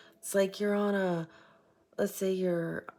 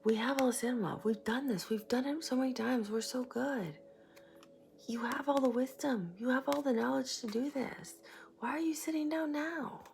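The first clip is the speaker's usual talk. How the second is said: encouraging spirit guide; 6 semitones higher than usual. Retold or imitated. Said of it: "your guide is right behind you, like".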